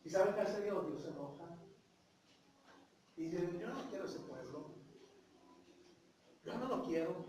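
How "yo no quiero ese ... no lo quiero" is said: God telling Moses he no longer wants this people; quiet stern God. Acted. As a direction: say it hushed and heavy, like a verdict. God angry rejects people.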